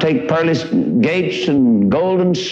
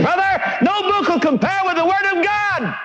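soaring high exclamation; ringing preacher's cry. Real, not acted. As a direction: cry it out like a preacher at full force. Preacher exclaiming at full force.

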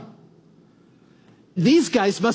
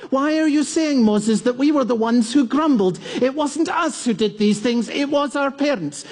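higher-pitched, protesting questioner from the crowd; indignant objector; 4 semitones higher than usual. Acted. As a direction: raise your hand and object to the leader. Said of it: "I'd want to have raised my hand".

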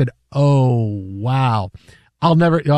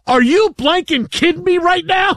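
mock furious shout; 13 semitones higher than usual. Wife in labor yelling at her yawning husband, mock furious shout. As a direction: scream it at him like she can't believe it. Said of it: "she goes".

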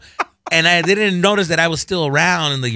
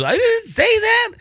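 mock crying kid denying to his dad. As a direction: imitate a sobbing kid denying it. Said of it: "And then he goes".

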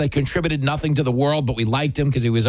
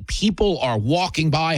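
ordinary talk

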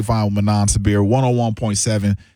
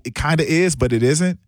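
usual speaking voice

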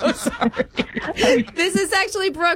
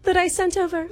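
quick, high mock-English question; hammed-up fake British accent; put-on British accent; 5 semitones higher, faster than usual. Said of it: "surprised by your accent".

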